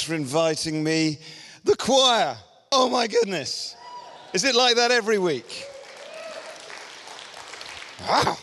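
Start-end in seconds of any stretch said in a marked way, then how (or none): 1.6-8.4 amazed delight. Real, not acted. Guest preacher marvelling at the church choir, excited and much higher. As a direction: gush about the choir like a thrilled visitor.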